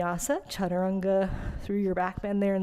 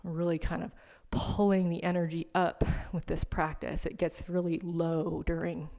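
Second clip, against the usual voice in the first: soft, lowered yoga-rest voice; guided meditation; 2 semitones lower, 5 dB quieter than usual. Real, not acted. Yoga teacher settling students into seated rest, soft meditation voice. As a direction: ease them into stillness, quietly and with long pauses.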